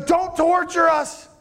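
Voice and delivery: a demon on its knees pleading with Jesus; begging demon. Acted. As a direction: grovel and beg for mercy. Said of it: "the demon gets down and he goes".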